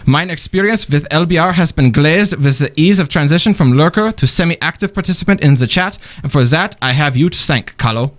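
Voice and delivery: formal email read in a German accent; mannered, courtly German-accented reading. Shown as a demonstration. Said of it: "in my German accent".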